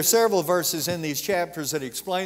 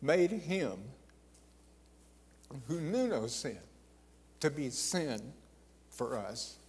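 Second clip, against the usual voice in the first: soft, slow and reverent, much softer; hushed reading; 8 dB quieter, slower than usual. Acted. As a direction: sink almost to a hush as you recite the verse. Reciting scripture on sin.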